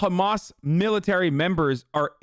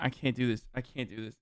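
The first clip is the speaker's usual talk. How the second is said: lower, much softer, giving up; defeated mutter; 6 semitones lower, 10 dB quieter than usual. Real, not acted. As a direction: sigh it out, worn down. Exasperated by clip.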